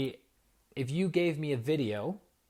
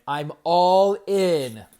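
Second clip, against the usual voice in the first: slow, much louder and higher, every word held; emotionally all in; 5 semitones higher, 14 dB louder, much slower than usual. Shown as a demonstration. Teacher showing what full emotional commitment sounds like, loud and slow. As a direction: declare total commitment, full force. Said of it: "Emotional commitment when you're like".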